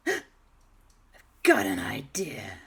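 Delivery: breath-only excited whisper; hushed revelation. Acted. Two friends revealing a sudden plan, hushed whisper. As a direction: whisper it like a secret brainwave.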